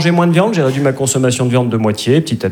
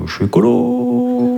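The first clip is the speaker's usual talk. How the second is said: much higher, slow and drawn out; high slow declaration; 10 semitones higher, slower than usual. Shown as a demonstration. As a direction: float it up high. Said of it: "variations sur la même phrase". (beaucoup plus aigu, lent)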